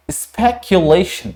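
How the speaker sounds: स्पेकुलेशन slowly and clearly, spek-yu-lay-shun; model pronunciation. Shown as a demonstration. Teacher giving the final pronunciation to the class. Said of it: "क्या प्रोनाउंसिएट करेंगे?".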